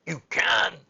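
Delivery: loud, forceful retort; shouting. A narrator's reading. Mary contradicting the sobbing boy, shouting. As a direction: shout him down. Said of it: "shouted Mary".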